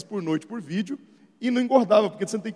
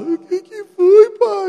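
much higher, slow little daughter; little girl voice; 13 semitones higher, 10 dB louder, much slower than usual. Retold or imitated. Daughter answering her dad's call, timid small child.

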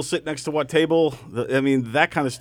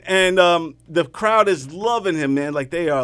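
ordinary talk

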